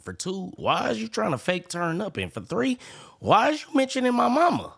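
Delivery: high-pitched mock scolding; mock indignation. Commentator ticking off complaints about the clip, mock indignation. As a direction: pitch up into incredulous, high questions.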